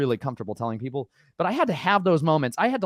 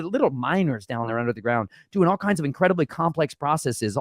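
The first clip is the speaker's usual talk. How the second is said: normal talking voice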